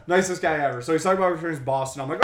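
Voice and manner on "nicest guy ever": ordinary talk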